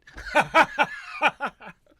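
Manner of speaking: high, loud burst of laughter; real cackling laughing fit. Real, not acted. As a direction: crack up loudly. Cracks up after the spoiler.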